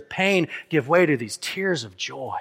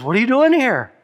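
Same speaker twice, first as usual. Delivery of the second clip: louder, higher retold question; 6 semitones higher, 5 dB louder than usual. Someone in glory asks the thief, louder and higher. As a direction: voice jumps up and out on the question.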